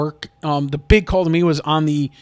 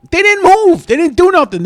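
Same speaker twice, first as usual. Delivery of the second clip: incredulous; 12 semitones higher, 9 dB louder than usual. Real, not acted. Fan ranting about linemen.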